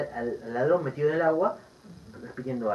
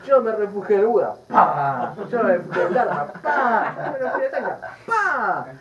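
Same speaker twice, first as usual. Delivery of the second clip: loud chant, a slam on each pa; laughing loud retelling of a beating; loud mock recitation; 5 semitones higher, 8 dB louder, slower than usual. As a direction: hammer out each line as if striking with a stick. Monk beats thief reciting refuge.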